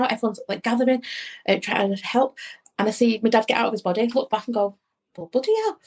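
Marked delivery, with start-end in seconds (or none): 5.2-5.8 high astonished exclamation, much higher; a dead man's gobsmacked reaction, pitched up; stunned surprise. Retold or imitated. Dad looking back at his own body, a high stunned exclamation.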